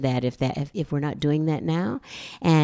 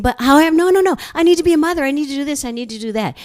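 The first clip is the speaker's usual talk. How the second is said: high, loud, fast protest; refusing Spirit, insisting she must be a mother; frantic refusal; 10 semitones higher, 6 dB louder, much faster than usual. Retold or imitated. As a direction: refuse in a rushed panic.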